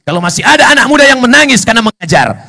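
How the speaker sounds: the same line repeated with mounting force; rising fervour. Acted. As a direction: drive it home with a rush. Contrast build, second take.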